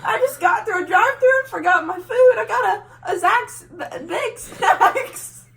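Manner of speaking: cracking up while quoting; laughing quote. Retold or imitated. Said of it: "she was like".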